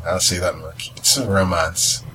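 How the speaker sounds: ordinary delivery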